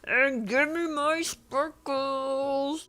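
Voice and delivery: slow, high theatrical proclamation; mock-dramatic demand. Edward steps into the midday sun, mock-dramatic demand.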